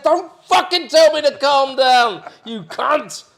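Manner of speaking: slow, loud, high mock furious retort; retold shouting. Renter snapping at the boat owner, mock furious. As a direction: bite back hard at being told to calm down. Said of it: "I said".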